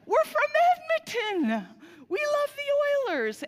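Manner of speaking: high, loud, stretched-out cheer of proud Canadians; excited fans shouting. Retold or imitated. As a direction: yell it like thrilled hockey fans spotting a fellow fan. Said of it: "they look at him and they go".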